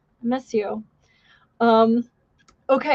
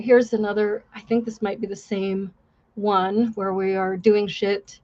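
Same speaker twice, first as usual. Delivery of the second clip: usual speaking voice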